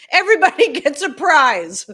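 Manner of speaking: loud, high call broken by a laugh; laughing cheer. Real, not acted.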